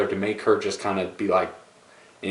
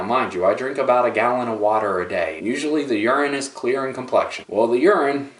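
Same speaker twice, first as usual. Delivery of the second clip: ordinary talk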